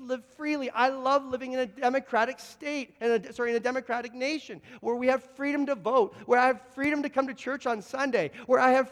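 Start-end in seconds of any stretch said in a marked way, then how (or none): none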